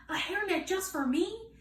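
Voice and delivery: high, amazed little question; can't believe it's for her; girl surprised by a gift; delighted surprise. Acted.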